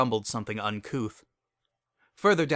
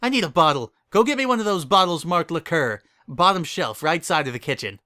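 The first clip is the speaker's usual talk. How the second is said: demanding; 6 semitones higher, 5 dB louder than usual. Acted. Berry demanding a bottle.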